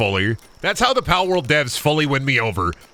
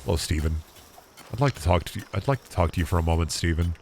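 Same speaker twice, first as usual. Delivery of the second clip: soft coaxing; 6 semitones lower, 5 dB quieter than usual. Acted. Talking softly to his pal.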